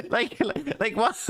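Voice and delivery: high, laughing like what; words bubbling through a laugh; laughing disbelief. Real, not acted.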